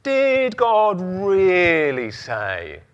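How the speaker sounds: slow, drawn-out insinuating question; sly tempter voice. Pretend. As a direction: tease out the doubt slowly. Voicing the devil's doubt.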